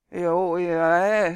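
loud, drawn-out wordless attempt to talk; slurred vowels pushed out loudly; garbled speech. A narrator's reading. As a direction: talk with a numb, useless tongue. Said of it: "dat het nergens op leek".

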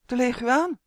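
high, startled exclamation; stammering alarm. A narrator's reading. Justin sees the monster in the doorway, stammering alarm. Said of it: "stamelde Justin".

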